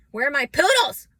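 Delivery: a high, loud put-on cry for the poodles; odd loud outburst. Real, not acted. Wondering aloud where her poodles went, loud put-on call.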